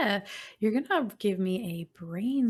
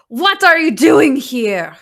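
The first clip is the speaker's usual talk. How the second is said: loud, high, slow witch's demand; booming old witch; 7 semitones higher, 15 dB louder than usual. Acted. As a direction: boom it out as the giant crone. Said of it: "you hear a voice boom".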